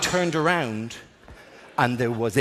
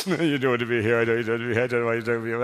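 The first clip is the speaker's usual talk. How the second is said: low, garbled imitation of crying; mock blubbering; 4 semitones lower, faster than usual. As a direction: blubber along in mock misery. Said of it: "looking at crying woman going".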